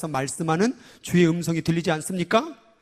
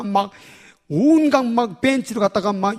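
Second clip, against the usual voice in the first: ordinary talk, nothing marked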